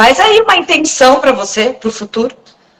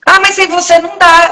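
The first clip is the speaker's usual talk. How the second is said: higher pleading husband voice, lightly mocked; whiny husband; 7 semitones higher than usual. Wife mocking husband who won't go without her, whiny. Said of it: "Eu falo".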